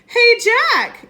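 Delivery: high, loud call. Acted. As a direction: shout down the hill. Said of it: "Annie called".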